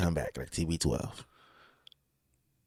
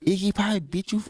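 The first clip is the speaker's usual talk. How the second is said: incredulous; 11 semitones higher than usual. Acted. Mocking incredulous repeat.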